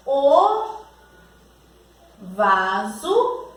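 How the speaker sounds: word by word with long pauses; slow dictation. Shown as a demonstration. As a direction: dictate at writing speed, one word at a time. Dictating while writing the sentence. (ditado lento, palavra por palavra)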